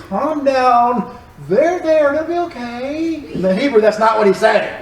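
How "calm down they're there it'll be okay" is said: gently calm them down, slowing and fading on "it'll be okay"; soothing reassurance. Retold or imitated. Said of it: "appears like he's saying".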